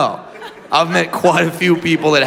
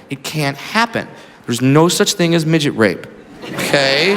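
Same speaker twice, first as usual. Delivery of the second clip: calm her down like a nervous patient; gentle reassurance; 8 semitones lower than usual. Retold or imitated. Comedian gently calms the woman.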